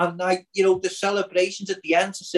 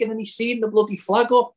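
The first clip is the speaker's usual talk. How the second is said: ordinary talk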